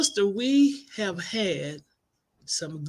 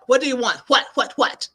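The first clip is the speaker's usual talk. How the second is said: loud high impatient whats; irritated what; 6 semitones higher, 6 dB louder than usual. Retold or imitated. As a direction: snap back impatiently. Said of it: "I was like".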